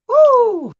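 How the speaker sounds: loud high whoop; excited whoop. Real, not acted. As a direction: whoop with delight. Hyped about a singer.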